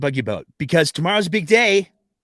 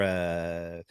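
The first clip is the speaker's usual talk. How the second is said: deep, hesitant Albertan drawl; prototypical Albertan voice; 9 semitones lower, 5 dB quieter, much slower than usual. Shown as a demonstration. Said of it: "my prototypical Albertan voice is a little bit more".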